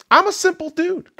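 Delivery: usual speaking voice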